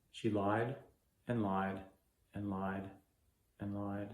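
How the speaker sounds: hurt, fading. Acted. Betrayed man dwelling on her lies, hurt and fading. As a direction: let each repeat sink further away. Acted grief over a betrayal.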